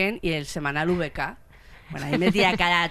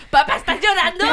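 teasing dad; 10 semitones higher, 8 dB louder than usual. Retold or imitated. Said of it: "le miraba en plan".

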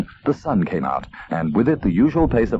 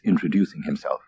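plain reading voice

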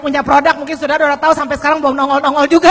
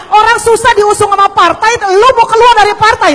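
higher and louder scolding; mock angry friends; 6 semitones higher, 5 dB louder than usual. Ahok's friends angry that he leaves the party, raised scolding. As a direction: retell the friends' angry protest. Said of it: "teman-temannya pada marah".

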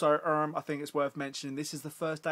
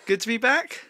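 teasing chirpy; 10 semitones higher, 8 dB louder than usual. Real, not acted. Teasing sister with questions.

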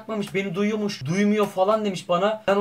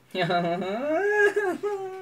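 despairing laugh-cry; 9 semitones higher than usual. Real, not acted. Despairing after failure.

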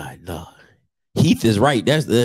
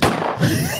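high laugh; 5 dB quieter than usual. Real, not acted. Laughing at beanie.

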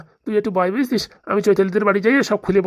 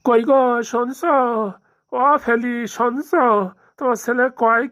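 high, drawn-out call across the house; bellowed call; 4 semitones higher, slower than usual. Acted. Husband summoning wife to hear what son says, bellowed call. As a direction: call out slowly with stretched endings. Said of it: "ধাম্বা সারের মতন চেঁচাচ্ছ কেন?".

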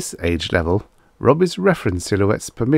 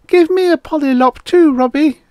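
put-on very high, loud little girl; high-pitched, insistent kid voice; demanding little girl; 8 dB louder than usual. A narrator's reading. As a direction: demand it like a small child. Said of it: "she demanded".

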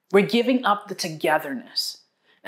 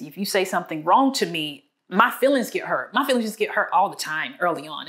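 normal talking voice

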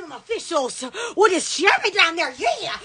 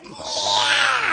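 held-back, airy scream, imitating a toilet; muted mimicked toilet screech; quiet imitated scream; 3 semitones higher than usual. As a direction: scream it under your breath. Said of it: "it's like a quiet".

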